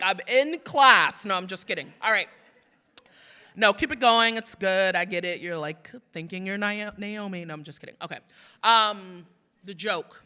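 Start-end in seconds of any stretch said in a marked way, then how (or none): none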